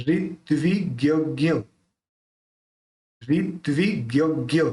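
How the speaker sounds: ऋत्विज्ञ को धीरे, एक-एक अक्षर साफ़; ऋत्विज्ञ धीरे-धीरे तोड़कर बोलें; धीमा उच्चारण. Shown as a demonstration. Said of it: "teacher repeats word slowly". (शिष्यों के लिए ऋत्विज्ञ धीमे दोहराना)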